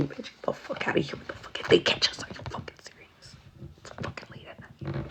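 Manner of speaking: urgent hiss in the dark; whispered scolding. Retold or imitated. Friend hissing so the owners won't catch them, urgent whisper. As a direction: whisper so nobody hears. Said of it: "I'd be the friend".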